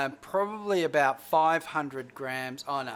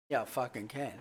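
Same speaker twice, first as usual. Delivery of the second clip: ordinary talk, nothing marked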